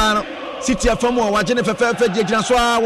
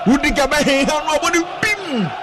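excited commentary; 7 semitones higher than usual. Acted. Live call of a clearance.

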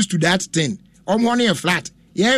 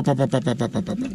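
repeated pa getting softer and lower; fading out; 6 semitones lower, 7 dB quieter than usual. Real, not acted. Host stressing good sense to listeners, the repeated pa fading away. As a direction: let the repeated pa fade to almost nothing.

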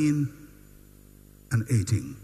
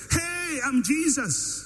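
higher, drawn-out mock announcement; mock self-announcement; 7 semitones higher, slower than usual. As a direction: announce yourself grandly, as a joke. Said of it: "He didn't come in whilst they were talking and say".

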